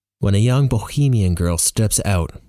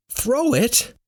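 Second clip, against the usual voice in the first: incredulous echo. Acted. Narrator questions the yelled order.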